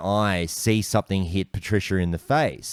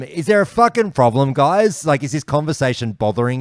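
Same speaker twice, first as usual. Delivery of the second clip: imagined angry challenge; 6 semitones higher, 3 dB louder than usual. Retold or imitated. Nearly cracking it at old blokes.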